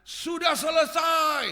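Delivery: high, loud and slow, ringing out; loud cry. Retold or imitated. Jesus on the cross crying out, slow loud call. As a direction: cry it out as a dying man's last words. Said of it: "ketika dia teriak".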